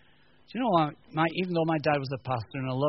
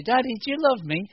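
put-on little kid asking; 10 semitones higher, 5 dB louder than usual. Kid voiced asking dad.

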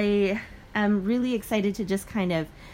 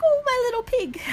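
very high cooing, imitating a doting teacher; 4 dB louder than usual. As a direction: coo it like a teacher to a pet pupil. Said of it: "And she'd be like".

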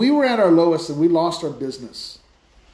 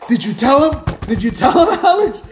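higher, louder, giddy calling out; wife shouting from the background, thrilled; excited wife; 8 semitones higher, 5 dB louder than usual. Retold or imitated. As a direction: call across the room, bursting with the news, laughing.

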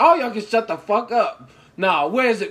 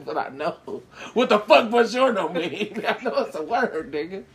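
ordinary talk

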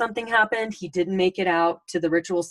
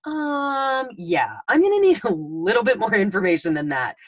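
mock skeptical; 3 semitones higher than usual. Young agent doubting her manager's explanation, amused skepticism. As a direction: push back with a laugh, politely unconvinced.

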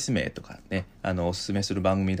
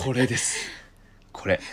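normal talking voice

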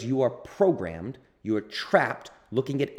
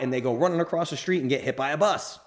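ordinary talk, nothing marked

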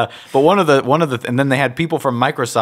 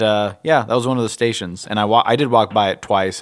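ordinary talk, nothing marked

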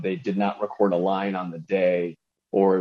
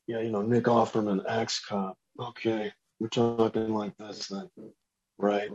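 Nick Offerman; 2 semitones higher, 6 dB quieter than usual. Acted. Showing a podcast host an impression, understated celebrity voice. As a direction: keep it quiet and understated, lightly impersonating. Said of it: "him I can actually, like".

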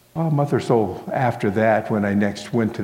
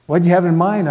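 louder, higher, mildly irked question; a touch of irritation, raised voice; twinge of annoyance; 8 semitones higher, 6 dB louder, faster than usual. A narrator's reading. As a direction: ask it as if slightly put out. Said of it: "with a twinge of annoyance".

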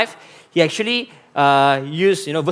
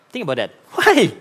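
ordinary delivery

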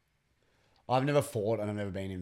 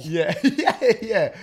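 laughing hard while agreeing; 11 semitones higher, 11 dB louder, much slower than usual. Real, not acted. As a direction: giggle through the yeahs.

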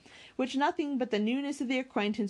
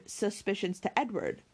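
ordinary delivery

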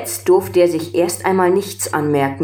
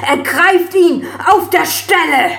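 high, loud furious order; furious shout; 13 semitones higher, 5 dB louder than usual. A narrator's reading. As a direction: scream the command. Emperor orders arrest.

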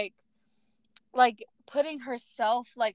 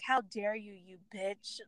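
muttered insult; 10 dB quieter than usual. Retold or imitated. Viewer muttering at a movie villain, scornful and soft. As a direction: mutter it under your breath in disgust.

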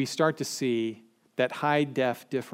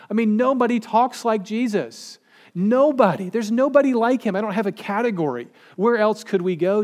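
high, loud, eager plea to Jesus; a fired-up disciple who has nowhere else to turn; excited Peter; 7 semitones higher, 5 dB louder than usual. Acted. Preacher voicing Peter to Jesus, high and excited.

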